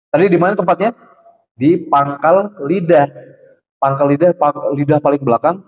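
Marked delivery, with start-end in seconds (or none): none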